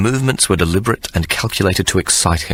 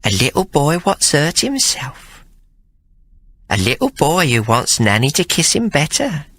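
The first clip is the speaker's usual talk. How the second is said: cooing nanny; 8 semitones higher than usual. A narrator's reading. Hannah humouring Sir Jasper, cooing nursery nanny. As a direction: talk to him like a small child.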